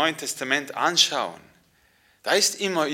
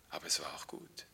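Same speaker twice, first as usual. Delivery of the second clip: whispered refrain; 9 semitones lower, 15 dB quieter than usual. Acted. Quiet refrain.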